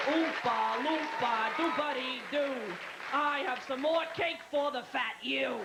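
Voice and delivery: slow, mocking little-worker chant; Oompa Loompa. Comic as cake-factory worker, slow put-on chant. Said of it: "with Oompa Loompas working".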